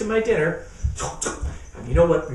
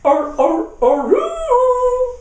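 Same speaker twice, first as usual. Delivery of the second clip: high loud wolf-like aroo; howling ringtone; 7 dB louder, much slower than usual. Acted. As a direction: howl it like a ringing phone. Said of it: "what Willie's ringtone sounds like".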